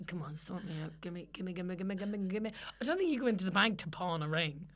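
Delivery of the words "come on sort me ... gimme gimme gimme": much softer, low wheedle for cash; mock low wheedling. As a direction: coax the teller under your breath, gimme on repeat. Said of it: "you can be like".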